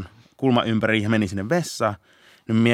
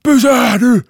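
high, loud police command; retold police stop shout; 11 dB louder, slower than usual. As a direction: bellow it after a runaway. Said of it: "sit mä sitä huuan".

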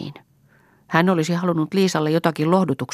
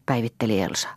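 ordinary delivery

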